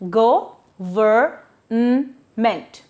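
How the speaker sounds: Go-ver-n-ment very slow, with the n on its own; syllable by syllable. Shown as a demonstration. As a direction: pull Go-ver-n-ment apart into four separate sounds. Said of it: "Don't forget to break them".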